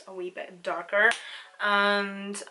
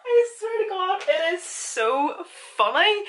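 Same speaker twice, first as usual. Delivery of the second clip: laughing; 11 semitones higher, 6 dB louder than usual. Real, not acted. Laughing about Scotland comments.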